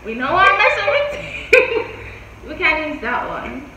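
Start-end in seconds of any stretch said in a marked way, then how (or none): 0.0-2.0 loud, slow, laughing through words. Real, not acted. Laughing while telling her off.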